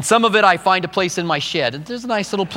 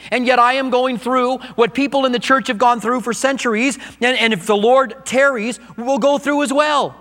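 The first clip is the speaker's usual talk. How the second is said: rising sermon; 8 semitones higher than usual. Acted. Preaching builds to full force.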